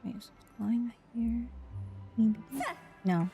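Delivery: ordinary delivery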